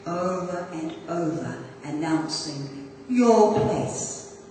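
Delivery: projected, emphatic poem reading with ringing line openings; carrying recital voice with deliberate pauses; poetry recitation. Acted. Reads Wild Geese poem.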